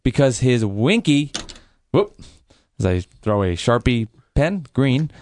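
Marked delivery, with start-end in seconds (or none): none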